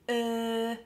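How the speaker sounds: drawn-out "uh" far forward in the mouth; French person thinking. Shown as a demonstration. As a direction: hold "uh" with lips pushed out, like a pondering French speaker. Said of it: "imagine you're a French person thinking".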